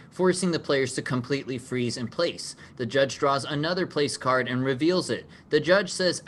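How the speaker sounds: ordinary talk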